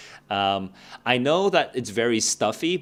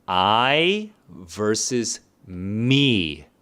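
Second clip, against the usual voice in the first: each pair said very slowly, second word weighed; slow contrastive reading; much slower than usual. Shown as a demonstration. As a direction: spell out I versus me slowly for the class. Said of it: "I want you to listen to this set of pronouns".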